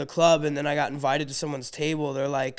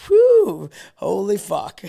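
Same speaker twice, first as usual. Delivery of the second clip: high startled whew then curse; stunned whew; 4 semitones higher, 10 dB louder, much slower than usual. Retold or imitated. Seeing the big crowd.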